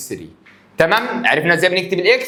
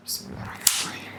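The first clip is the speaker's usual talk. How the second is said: whispered blessing; 13 dB quieter, much faster than usual. Real, not acted. Whispered blessing before starting.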